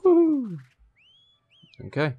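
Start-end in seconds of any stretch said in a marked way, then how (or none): 0.0-0.6 excited whoop. Real, not acted. Excited cheer in game.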